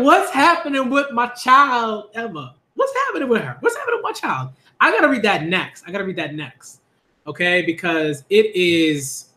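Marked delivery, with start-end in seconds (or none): 0.0-4.5 lightly hammed-up worried mom; mock worried parent. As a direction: whine like a fussing parent. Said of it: "I'm like".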